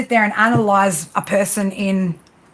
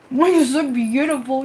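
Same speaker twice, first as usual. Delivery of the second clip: higher, tender praise to the cat; doting praise; 4 semitones higher than usual. Real, not acted.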